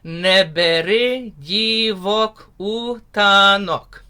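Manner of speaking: slow spoken "Neberi divok utanok"; spoken lyric line. Shown as a demonstration. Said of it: "Everyone say that with me".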